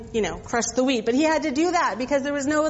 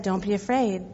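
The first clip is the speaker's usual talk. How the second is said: low, soft and calming; softly reassuring angel, lower and quieter; gentle reassurance; 6 semitones lower, 3 dB quieter than usual. Acted. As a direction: soothe a frightened man. Angel reassures frightened Gideon.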